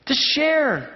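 usual speaking voice

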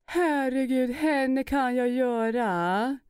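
slow, high, drawn-out socialite voice; Maria Montazami impression. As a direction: slip into the glamorous reality star, slowly. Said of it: "Maria Montazami".

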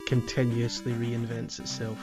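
ordinary delivery

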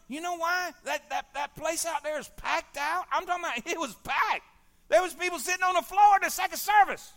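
loud, high, fiery sermon build; full-force preaching. Acted. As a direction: preach it hard to the congregation.

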